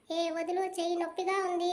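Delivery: ordinary delivery, nothing marked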